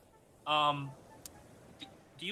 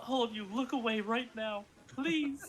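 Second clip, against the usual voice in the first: flustered plea; 8 semitones higher than usual. Acted. Liam pleading.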